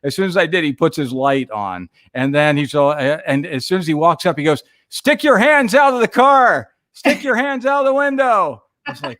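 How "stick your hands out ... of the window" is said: loud, high barked command; police officer ordering a driver, loud; cop's order. Retold or imitated. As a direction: bark it like a jumpy cop. Cop ordering him out.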